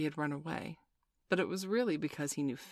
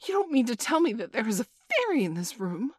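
high, louder, incredulous question; thrilled cry; 8 semitones higher, 6 dB louder than usual. A narrator's reading. Wendy learns a fairy is in the room, thrilled cry. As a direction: exclaim it high and astonished. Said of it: "she cried".

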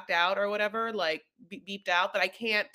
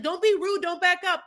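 higher, louder commanding shout; bossy yelling; 10 semitones higher, 6 dB louder than usual. Retold or imitated. Family yelling at couple through car window, bossy. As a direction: order them to stay put.

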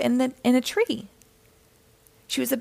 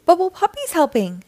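higher-pitched, lightly excited announcement; excited exclamation; 7 semitones higher than usual. A narrator's reading. Gil seeing the puppy join in, excited exclamation. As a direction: announce good news with lifted pitch. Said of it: "exclaimed Gil".

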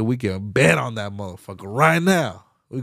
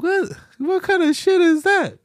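incredulous. Real, not acted. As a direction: squeal it in disbelief. Outraged rhetorical question.

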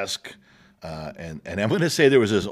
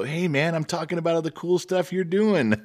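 mock older self; 11 semitones higher, 4 dB louder than usual. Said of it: "look at my younger self ... and go".

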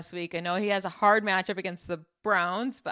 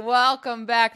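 excited; 3 semitones higher, 9 dB louder, slower than usual. Real, not acted. Echoing the gleeful welcome.

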